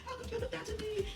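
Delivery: high, whiny fake sobbing; mock crying. Mocking the sister crying after the plate smash, high whine. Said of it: "And then she starts crying".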